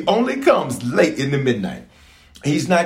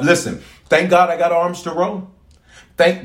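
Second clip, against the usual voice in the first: normal talking voice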